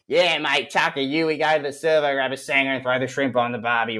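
broad mock Aussie accent. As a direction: parody a slang-slinging Australian accent. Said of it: "whatever that stereotype is".

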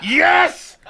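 high, loud, drawn-out cry of yes; hammed-up high shouted yes; elated shout. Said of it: "I turned around and she was crying".

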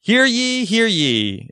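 booming proclamation, high first call; town crier. Pretend. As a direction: open it like a herald in the square. Announcing start of podcast bracket.

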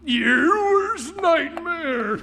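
very slow drawn-out monster threat; menacing monster. Acted. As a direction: draw it out like a looming beast. Monster answers slowly.

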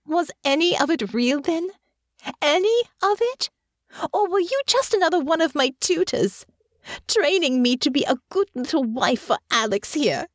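high, anguished accusation; rising anger. Acted. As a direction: pitch climbs as the hurt boils over. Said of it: "her voice rising in pitch and volume".

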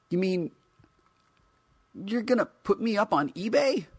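high incredulous figure voice; indignant toy voice. Acted. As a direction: squeak out the disbelief.

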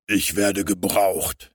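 slow, loud superhero declaration; deep-voiced hero. A narrator's reading. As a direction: announce it like a masked hero, drawn out. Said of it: "mit seiner erstaunlich tiefen Stimme". (tiefe Heldenstimme)

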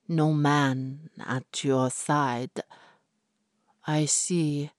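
slow, lightly voiced ominous seer; mystic fortune teller. Fortune teller reads Florrie's fate, slow and ominous. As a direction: intone the prophecy slowly and darkly.

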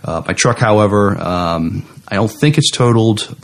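ordinary talk, nothing marked

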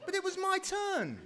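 high whiny complaint; whiny villain. Acted. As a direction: whine like a thwarted child. Villain whines, laughing.

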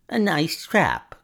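loud, slow, high-pitched snarl; cornered villain's high snarl; high, snarling cry. A narrator's reading. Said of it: "cried the high, snarling voice".

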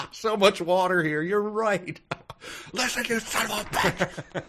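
high, giggly put-on voice, laughing through it; a silly high guest voice breaking into laughs; mock dinner guests. Guests mock the water spill, high giggling voice. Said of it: "Everyone else is like".

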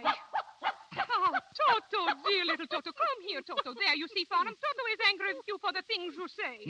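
high, loud cooing at the little dog; baby talk to dog. Acted. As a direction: coo over the pet like a fussy diva. Diva cooing to her dog.

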